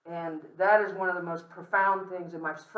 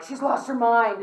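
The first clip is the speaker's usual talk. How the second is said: higher, louder, slowed mock alarm; mock alarmed relative; 6 semitones higher, 11 dB louder, slower than usual. Family at a deathbed, mock alarmed and louder. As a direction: play a relative who is scandalised. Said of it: "people are like".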